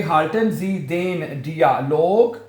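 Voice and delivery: halten Sie den Dialog, slow and clear; slow demo. Shown as a demonstration. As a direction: model the full sentence slowly for the class. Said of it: "dialog हैं". (धीमा, साफ़ जुमला)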